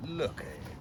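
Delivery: soft, almost breathless admiration; quiet wonder over the catch; hushed awe. Real, not acted. Angler marvelling at his fish on the mat, hushed awe.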